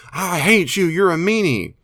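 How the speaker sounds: mock a toddler's tantrum; spoiled child tantrum. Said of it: "petulant or angry fighting child".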